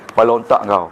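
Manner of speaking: low, scolding Terengganu insult; lightly acted angry Terengganu insult, low-pitched; mock Terengganu anger. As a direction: bark it out like an angry Terengganu man. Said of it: "dia marah sangat".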